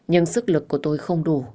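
plain reading voice

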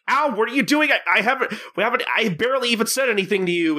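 high-pitched, indignant retold protest; pained mock protest. Shopkeeper held up by the nose by Gin, high mock protest.

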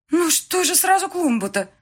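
high, loud, bewildered protest; flustered woman. A narrator's reading. Registry clerk bewildered by the ruined flowerbed, flustered. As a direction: protest helplessly, pitch right up. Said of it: "растерянно ответила женщина".

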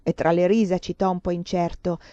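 usual speaking voice

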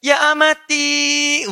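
slow, high, sweet call of Ya amati; high drawn-out Ya amati, like a doting child; child calling mother. Pretend. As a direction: sweetly call your mum in Arabic. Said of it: "Anda panggil ibunda Anda".

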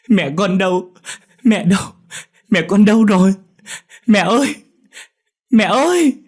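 very high, loud anguished sobbing; shrieking cry. A narrator's reading. Dậu staring at the fire, wailing for mother. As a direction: wail desperately for mother. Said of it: "khóc ré lên".

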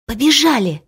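usual speaking voice

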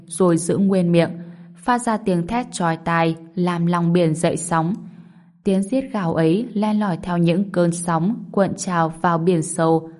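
usual speaking voice

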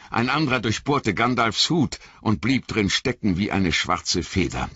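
usual speaking voice

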